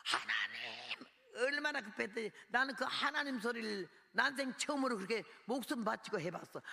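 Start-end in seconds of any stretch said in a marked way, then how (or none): 0.1-1.1 desperate call. Retold or imitated. Hair yanked, cries to God.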